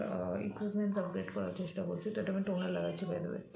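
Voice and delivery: ordinary talk, nothing marked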